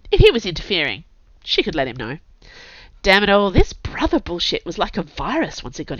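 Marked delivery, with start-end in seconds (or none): none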